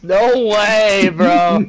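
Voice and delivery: slow, loud cry through laughter; laughing shout. Real, not acted. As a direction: bellow it while cracking up. Teammate killed, both howling.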